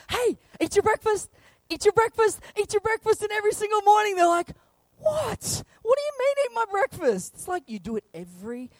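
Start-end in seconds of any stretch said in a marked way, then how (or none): 0.1-3.2 high, repeated, louder nag; nagging dad. Retold or imitated. Dad nagging the kids to eat breakfast, high and insistent. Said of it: "every single morning you have to say". 5.0-7.3 put-on a much higher, bewildered child voice; baffled kid. Child baffled by being told to eat breakfast, much higher.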